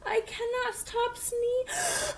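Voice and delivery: drawn-out slow whine, sneeze breaking in; slow whimpering. Acted. Gerald telling Piggy he cannot stop sneezing, slow whine. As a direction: plead helplessly as another sneeze builds.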